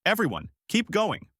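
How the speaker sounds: high-pitched rallying call; mild call to everyone working. A narrator's reading. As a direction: urge the group on, pitch well up. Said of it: "calling out".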